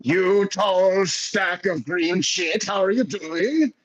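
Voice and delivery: slow, high, booming welcome; hearty old-timer greeting. Acted. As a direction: call out to an old friend across the street.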